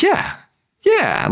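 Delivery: very high, wavering yeah; unsure genie. Retold or imitated. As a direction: squeak it out unsure. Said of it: "kinda, like, unsure of it".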